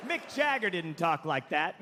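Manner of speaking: ordinary talk, nothing marked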